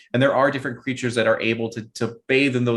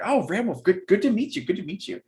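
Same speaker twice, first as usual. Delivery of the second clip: nervous Belle; 8 semitones higher than usual. Acted. Belle nervously greets Ram Wolf.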